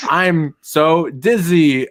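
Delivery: slow, dragged-out dizzy drawl; mock dizzy. Friend retelling the drug scene, slow dizzy mock voice. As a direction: stretch it out like you're reeling. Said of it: "He's like".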